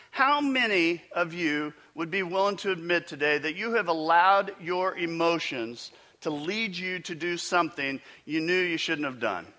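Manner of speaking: ordinary talk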